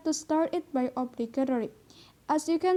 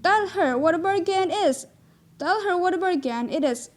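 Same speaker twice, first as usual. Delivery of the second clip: "Tell her what a bargain it is" with a raised, emphatic start; exclamation demo; 4 semitones higher, 4 dB louder than usual. Shown as a demonstration. Teacher models an exclamative sentence, emphatic. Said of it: "Then we say it like this".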